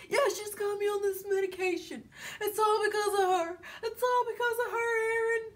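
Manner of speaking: high, whiny, tearful complaint; mock wailing. Imitating a man blaming his ex for his medication, mock wailing. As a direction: imitate someone sobbing out blame.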